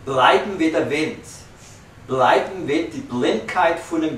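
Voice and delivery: measured, musical poem reading, each line opening high and strong; solemn Yiddish verse with pauses between lines; poetry recitation in Yiddish. Acted. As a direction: recite it like music, leaning on the rhythm.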